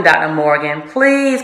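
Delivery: normal talking voice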